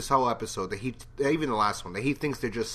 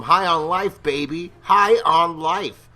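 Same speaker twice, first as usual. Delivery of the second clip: slow, louder, stretched high on life; drawn-out cheer; 5 semitones higher, 7 dB louder, slower than usual. Real, not acted. Vlogger proudly telling viewers he is high on life. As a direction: savor every word.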